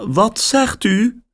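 surprised caller on the line; woman's voice. A narrator's reading. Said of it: "vraagt de vrouw".

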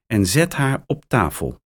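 ordinary delivery, nothing marked

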